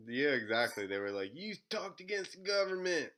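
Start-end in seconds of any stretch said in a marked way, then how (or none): 1.3-3.1 high-pitched put-on Southern voice; mock Southern accent. Masons rejecting him over web history, mock Southern accent. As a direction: play a Southern accuser, pitched up. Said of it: "I don't know why they got Southern".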